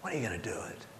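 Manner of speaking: near-whispered, intent question; hushed challenge. Real, not acted. Preacher pressing listeners for a decision, hushed. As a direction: drop right down to a low whisper.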